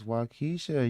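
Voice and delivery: Waukesha as "waw-KEE-shuh"; insisted-on Waukesha. Shown as a demonstration. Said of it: "You don't tell me how to pronounce it".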